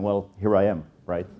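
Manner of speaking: ordinary talk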